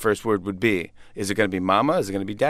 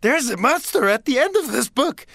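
much higher, louder puppet voice; high squeaky Grover warning; 14 semitones higher, 3 dB louder than usual. Retold or imitated. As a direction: warn like a scared Muppet. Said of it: "He's like".

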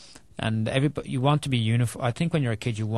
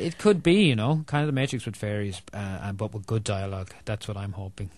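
ordinary talk

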